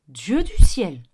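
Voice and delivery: loud, low astonished exclamation; booming amazed cry; exclaiming. A narrator's reading. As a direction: burst out in astonishment. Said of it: "s'exclama-t-il".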